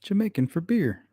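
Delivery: lightly put-on Jamaican accent. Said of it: "Jamaican accent".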